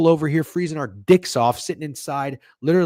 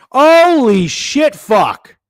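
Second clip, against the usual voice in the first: loud, high, drawn-out exclamations; thrilled outburst; 9 semitones higher, 9 dB louder, slower than usual. Real, not acted. Bettor discovering a Stanley Cup rematch, thrilled. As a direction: holler in amazed delight.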